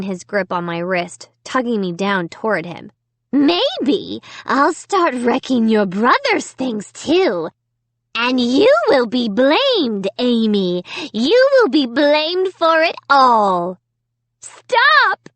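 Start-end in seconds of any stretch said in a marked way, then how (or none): 3.3-13.8 slow, louder gloating menace; gloating dummy. A narrator's reading. Dummy threatening Amy, slow gloating menace. 14.4-15.3 high, loud desperate cry. A narrator's reading. Amy begging the dummy to stop, a loud cry. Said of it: "I cried".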